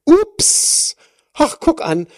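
high, loud mock-surprised ups; a big playful "ups" as if cash just fell out; exaggerated ups. Shown as a demonstration. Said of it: "ich betone das ganz bewusst so". (übertrieben hohes, lautes, gespielt überraschtes „ups“)

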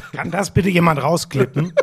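cracking, laughing voice; voice giving out. Real, not acted. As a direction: voice failing mid-laugh. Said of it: "meine Stimme versagt direkt".

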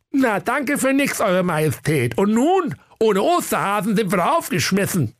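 high cartoon mayor voice, grumpy; a put-out small-town mayor. Mayor thanks the king sarcastically, high voice. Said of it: "Bürgermeister Bummelbier sprach".

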